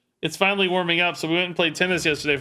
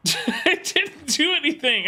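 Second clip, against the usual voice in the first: laughing at himself; 11 semitones higher, slower than usual. Real, not acted. Laughing at his own misplay.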